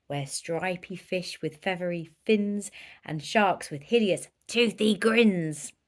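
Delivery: plain reading voice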